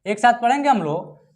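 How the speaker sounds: normal talking voice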